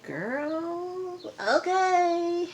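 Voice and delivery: high, drawn-out sassy okay; sassy girl okay. Retold or imitated. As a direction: react with a knowing, sassy okay. And I'm like.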